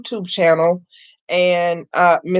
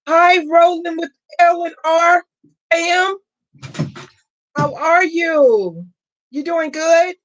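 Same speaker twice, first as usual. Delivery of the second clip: much higher, sing-song hello; high, happy sing-song welcome to a viewer; bright greeting; 11 semitones higher than usual. Real, not acted. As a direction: greet a friend who just walked in. Greeting a chat viewer warmly.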